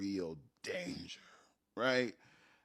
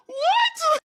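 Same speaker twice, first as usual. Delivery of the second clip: shocked what; 14 dB louder than usual. Retold or imitated. Niece shocked by the omelets.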